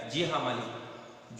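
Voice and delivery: soft, slow, timid yes sir; frightened governess answers her employer softly; timid trembling reply. A narrator's reading. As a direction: shrink back and murmur it. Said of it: "आवाज कांपने लगती है".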